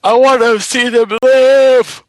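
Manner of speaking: mock dramatic plea.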